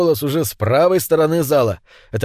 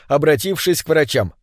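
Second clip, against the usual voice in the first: ordinary delivery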